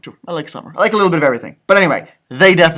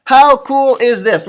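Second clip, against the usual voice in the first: excited; 7 semitones higher, 7 dB louder than usual. Real, not acted. Excited reader aside to kids.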